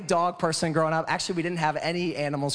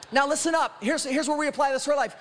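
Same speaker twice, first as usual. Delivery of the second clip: higher, rhythmic litany, each title proclaimed; full-force preaching; 7 semitones higher than usual. Real, not acted. Preacher answering "who is this Jesus" for the church, full-force preaching.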